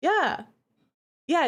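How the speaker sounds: normal talking voice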